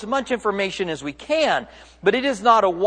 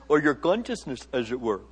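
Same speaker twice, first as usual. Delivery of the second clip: badly faked Indian accent, low and soft; 6 semitones lower, 7 dB quieter than usual. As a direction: toss it off softly in a poor Indian accent. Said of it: "Very bad Indian accent".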